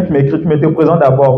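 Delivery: ordinary talk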